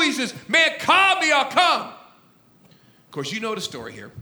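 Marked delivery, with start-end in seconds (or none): 0.5-1.9 high, hollered plea; raised mock shout; Peter yelling. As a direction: holler it out eagerly, pitched up. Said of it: "he hollers".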